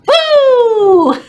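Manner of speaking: sudden high, loud boo; playful scare shout. Pretend. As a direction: jump out and scare them. Chant ends in scare.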